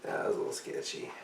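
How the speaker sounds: unvoiced spooky whisper. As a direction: whisper it like a secret.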